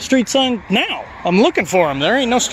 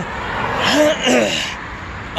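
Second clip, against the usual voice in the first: harsh cough that hurts; coughing through collarbone pain; painful cough; 4 semitones higher than usual. Real, not acted. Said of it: "then when I cough".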